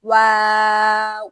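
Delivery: واو drawn out very slowly and loudly; letter waw stretched out in a slow, loud Qur'anic model; demo pronunciation. Shown as a demonstration. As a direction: hold the واو long and full, as a model for students. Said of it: "اس طرح ہی ادا کریں".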